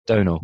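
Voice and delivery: Donal said as "DOH-nal", long o, silent m; corrected pronunciation. Shown as a demonstration. Host corrects the co-host's attempt, pronunciation demo. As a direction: say Donal with a long first vowel. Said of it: "give it to me one more time".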